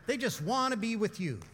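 ordinary delivery

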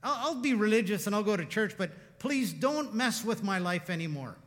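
preacher replaying his rant at God, heated; little rant. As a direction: vent it like a hurt man fed up. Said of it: "let me have my little rant".

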